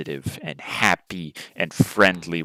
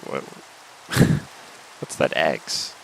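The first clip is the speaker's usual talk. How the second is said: ordinary talk, nothing marked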